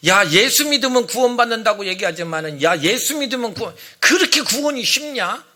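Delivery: hectoring mock opponent's rebuke; scornful scolding. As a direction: take believers to task, incredulous. Acted opponents scolding believers.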